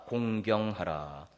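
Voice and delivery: drawn-out, solemn "공경하라." said slowly for the congregation to repeat; slow emphatic. Shown as a demonstration. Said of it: "한번 따라서 합시다".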